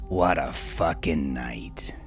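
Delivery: ordinary delivery, nothing marked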